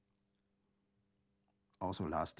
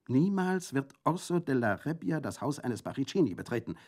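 loud, higher, fierce refusal; highly agitated; 8 semitones higher, 8 dB louder than usual. A narrator's reading. Colomba refuses the prefect's plan, highly agitated. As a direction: cry it out in full agitation. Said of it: "rief Colomba in höchster Erregung".

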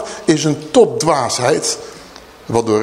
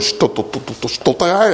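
mock stutter. Shown as a demonstration. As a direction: stutter on the t, stuck and repeating. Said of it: "Mozes was een stotteraar". (nagespeeld gestotter, blijft haken op de t)

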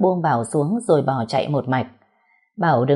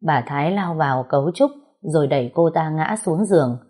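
usual speaking voice

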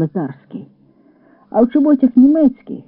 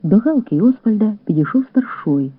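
ordinary delivery

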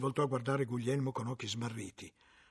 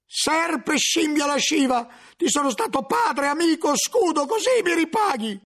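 much higher and louder, a betrayed man's raging cry; furious outburst; 10 dB louder than usual. Acted. Cellarer screams at his former protégé, enraged yell. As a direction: spit the insults out in fury. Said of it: "non poté trattenersi dal gridargli".

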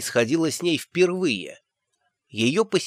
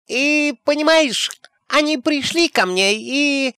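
high, slow gossipy granny voice; fluttery old nanny voice; high chatty old woman; 12 semitones higher than usual. Acted. Nanny Ogg speaking, high voice.

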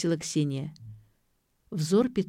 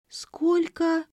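high-pitched aghast question; stunned, high and drawn-out; gasping in shock; 12 semitones higher than usual. A narrator's reading. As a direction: gasp it out in disbelief. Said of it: "ахнула Ксения".

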